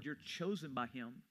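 normal talking voice

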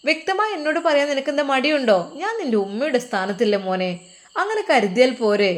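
insistent motherly elder. Acted. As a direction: press him firmly but affectionately to speak up.

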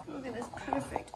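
soft whispered aside. Real, not acted. Whispering to the viewers about her calf. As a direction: hush it near the calf.

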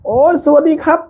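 high, surprised cry of greeting; excited exclaim. Retold or imitated. Chang Tum greeting a viewer, excited high exclaim. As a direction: imitate a startled, delighted hello. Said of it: "จู่ๆช่างตั้มร้อง".

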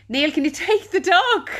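calling out to husband to take the dog. Real, not acted. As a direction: call to husband in another room.

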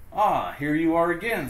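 high, welcoming greeting; friendly ladybug. Acted. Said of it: "said the friendly ladybug".

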